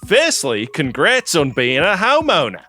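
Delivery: lightly put-on Aussie accent reading the message; a hint of Australian twang. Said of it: "should I do this in the accent?".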